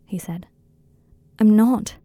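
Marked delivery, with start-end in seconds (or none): 1.4-2.0 louder, insistent denial; desperate protest, suddenly louder. A narrator's reading. Harry protests being called perfect, desperate.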